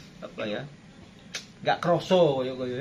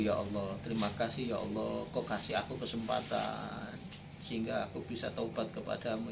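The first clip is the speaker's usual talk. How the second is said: softly pleading prayer; 4 semitones lower, 7 dB quieter than usual. Acted. Acts out a tearful repentant prayer.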